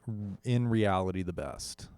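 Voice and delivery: normal talking voice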